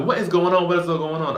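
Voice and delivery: baffled coworkers asking at once; confused staff. Retold or imitated. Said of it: "we're all like".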